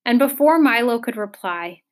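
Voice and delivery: ordinary delivery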